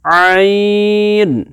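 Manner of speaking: teacher demonstrates عین from the throat; throat sound demo. Shown as a demonstration. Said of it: "ایسے sound میں دکھاتا ہوں آپ کو".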